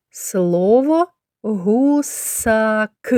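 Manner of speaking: very slow, clear "Слово місяць"; slow teacher's dictation; slow word naming. Shown as a demonstration. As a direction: sound out the word for children. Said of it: "teacher sounding out the word".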